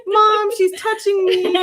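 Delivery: high, slow whining little sister; whiny kid. Pretend. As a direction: tattle to mom like a child. Sisters joking like bickering kids.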